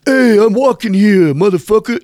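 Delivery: high, loud New York street bluster; New York tough guy. Retold or imitated. Imitating the New York bass player he jammed with, tough New York accent. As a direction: bark it like a brash New Yorker. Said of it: "that kind of tough".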